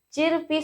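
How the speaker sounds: चिरपी read straight as spelt, R sounded; mispronounced chirpy. Shown as a demonstration. Teacher quoting how people misread it, चिरपी with audible R. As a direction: say "chir-pee" for चिरपी. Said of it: "चिरपी सीधा pronounce करते हैं".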